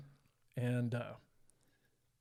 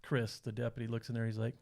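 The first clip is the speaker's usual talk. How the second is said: usual speaking voice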